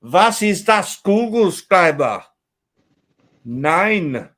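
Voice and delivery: loud, high-pitched mock German; hammed-up German accent, punched out. Man clowning in German at the table, hammed-up German accent. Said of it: "give us your German accent".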